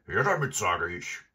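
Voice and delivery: loud, gruff robber order; barked demand. Acted. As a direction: bellow a threatening demand. Robber repeats his demand.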